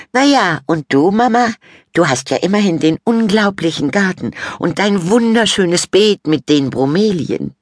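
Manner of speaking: put-on high, loud teen girl; condescending praise for mum; vain Fabienne. A narrator's reading. Sister condescendingly praising mum's garden, high and loud.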